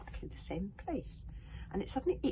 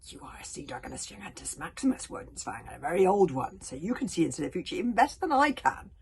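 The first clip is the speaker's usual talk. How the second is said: bitter dragon; 11 dB louder than usual. Acted. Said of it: "tone was still bitterly resentful".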